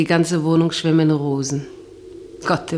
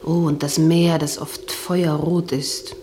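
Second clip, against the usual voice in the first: normal talking voice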